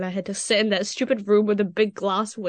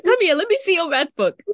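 mock teacher demand, higher and louder; stern teacher; 8 semitones higher, 6 dB louder than usual. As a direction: imitate the teacher snapping an order. Teacher demands math book.

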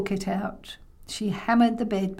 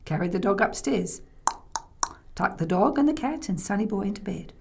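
ordinary delivery

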